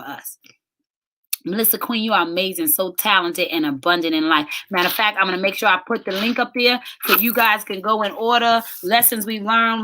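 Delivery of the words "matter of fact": ordinary delivery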